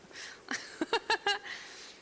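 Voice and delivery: airy high giggle; breathy giggle. Real, not acted. Laughing at own mixup.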